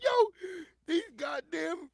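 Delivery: high, loud yell, slowing on goddamn; panicked yell. Retold or imitated. Retelling his own panic at the driver, loud high yell. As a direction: yell it in alarm. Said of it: "I'm like".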